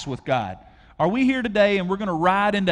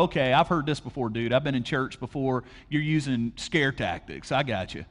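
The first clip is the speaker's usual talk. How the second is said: lower, dismissive skeptic voice; skeptical churchgoer; 6 semitones lower, 3 dB quieter than usual. Retold or imitated. Imagined listener to pastor, low and dismissive. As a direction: brush the preacher off, a bit lower. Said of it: "And you go".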